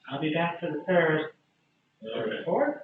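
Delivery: put-on soft, high elderly halfling; high-voiced, quiet old woman. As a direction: speak small and high, like an old server. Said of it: "older female halfling, very old".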